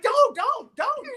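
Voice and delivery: very high, loud shrieked "don't"; shrieking kid. Retold or imitated. Boy shrieks at dad during the sale, high shriek. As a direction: scream it like a kid losing it. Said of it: "I'm like".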